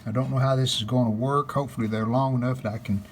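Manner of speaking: ordinary talk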